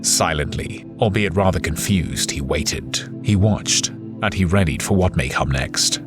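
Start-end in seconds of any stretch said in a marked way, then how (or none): none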